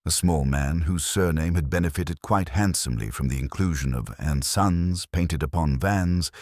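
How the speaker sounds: normal talking voice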